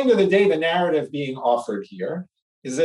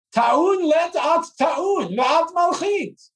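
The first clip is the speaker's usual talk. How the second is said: high, slow incredulous scolding; exasperated donkey; 12 semitones higher, slower than usual. Acted. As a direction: scold the dog in disbelief. Donkey speaks to the dog.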